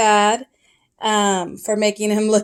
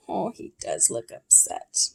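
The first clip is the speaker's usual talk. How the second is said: ordinary delivery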